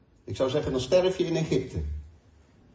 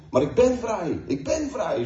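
mild mock shout, higher; retold shout; 8 semitones higher, 4 dB louder than usual. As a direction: echo the friend's defiant cry, half-shouted. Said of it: "schreeuwde hij".

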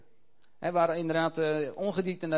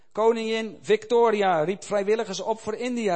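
loud, higher, slowed proclamation; calling out; 5 semitones higher, 6 dB louder than usual. A narrator's reading. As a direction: proclaim it to the hall. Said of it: "riep hij uit".

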